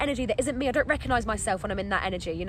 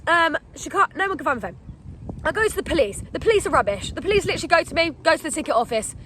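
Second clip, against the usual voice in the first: ordinary delivery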